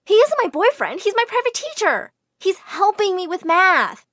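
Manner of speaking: loud, high-pitched indignant objection; shouted protest. Acted. Girl defending her private teacher to the widow, protesting loudly. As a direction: plead your innocence at full pitch.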